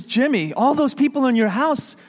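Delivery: high, loud, mounting exasperation; exasperated telemarketer. Acted. Telemarketer demands answers from little boy, exasperated.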